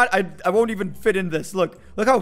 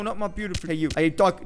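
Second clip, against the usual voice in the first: ordinary talk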